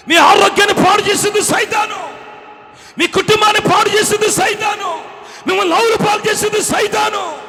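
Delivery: very high, shouted accusations; relentless, strained pulpit shouting; preaching at full force. Real, not acted. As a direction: hammer each charge against Satan. Preaches against Satan.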